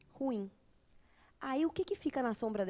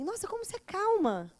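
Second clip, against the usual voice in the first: admiring surprise; 7 semitones higher than usual. Retold or imitated. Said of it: "falar assim".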